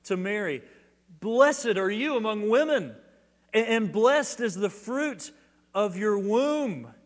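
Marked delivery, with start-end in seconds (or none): 1.2-6.9 loud, slow, ringing blessing; joyful cry. Retold or imitated. Preacher voicing Elizabeth crying out to Mary, loud and joyful. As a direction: cry it out in joy, higher. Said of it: "She cries out".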